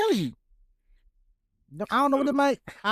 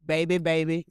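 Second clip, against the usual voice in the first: flat reading of lyrics; 6 semitones lower than usual. Pretend. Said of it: "Why you reading it like that?".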